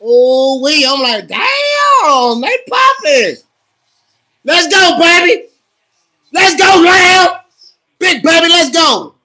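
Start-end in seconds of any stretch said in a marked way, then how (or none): 1.3-3.4 high, drawn-out excited yell; hyped shout. Real, not acted. Hyped reaction to the game. 4.4-9.1 high, loud fan shouts; hyped cheering. Real, not acted. Cheering the team on.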